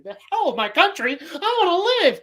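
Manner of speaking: rising high whiny protest; hammed-up panic; cowardly Kent. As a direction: beg to live, mock coward. Kent's cowardly retort.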